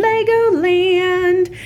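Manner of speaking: high, slow, thrilled shout; excited. Real, not acted. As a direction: draw it out with delight. Answer to weekend trip question.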